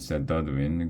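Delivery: ordinary delivery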